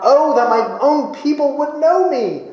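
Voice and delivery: a longing cry from a grieving God; God's plea, high and aching; wistful cry. Acted. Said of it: "God is almost wistfully crying out".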